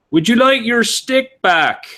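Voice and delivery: high, slow, drawn-out question; singsong high character voice; naive wanderer offering. Acted. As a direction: call it out slowly and innocently. Character calls to the javelin thrower.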